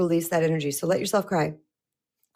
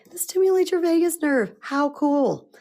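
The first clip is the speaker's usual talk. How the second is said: excited; 9 semitones higher than usual. Real, not acted. Excited about new trick.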